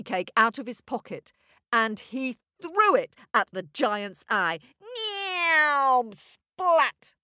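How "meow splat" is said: high, comic sound effect; high squeaky splat. Acted. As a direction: squeal it like a cartoon hit.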